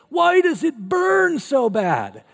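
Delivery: high, loud, slow wail; whining kid. Retold or imitated. Little boy crying about his burning stomach, high wail. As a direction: whine like a kid in pain.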